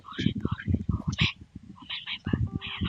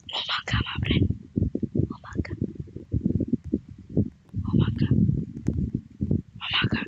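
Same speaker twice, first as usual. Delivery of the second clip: whisper; 4 dB quieter than usual. Acted. Sneaking greeting.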